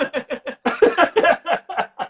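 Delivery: high, loud burst of laughter; real laughter. Real, not acted. Comic laughing about a failed accent, real laughter. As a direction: crack up at your own story.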